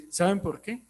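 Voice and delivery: normal talking voice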